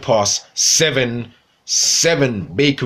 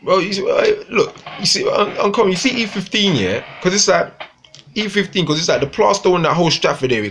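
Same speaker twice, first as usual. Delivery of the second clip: pitched way up, fired up about Stratford; high excited rant; 8 semitones higher than usual. Real, not acted. Ranting to viewers about Stratford, excitedly high. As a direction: voice jumps high with disbelief.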